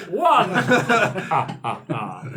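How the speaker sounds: vampire tallying the ships with a laugh; counting vampire. Acted. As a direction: play a dim vampire counting boats.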